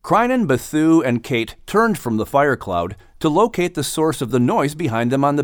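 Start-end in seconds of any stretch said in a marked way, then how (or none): none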